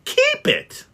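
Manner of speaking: can't believe what he hears; incredulous. Acted. Nephew astonished at Scrooge, shrill disbelieving question.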